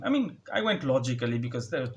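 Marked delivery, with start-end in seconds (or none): none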